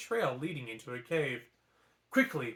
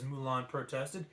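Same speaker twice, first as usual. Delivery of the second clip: ordinary delivery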